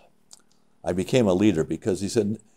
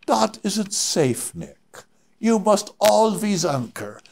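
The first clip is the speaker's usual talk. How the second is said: put-on high, slow, deliberate Swiss accent; drawn-out mock Swiss scolding; 13 semitones higher, much slower than usual. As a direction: imitate a Swiss climber sternly instructing. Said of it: "he has his Swiss accent".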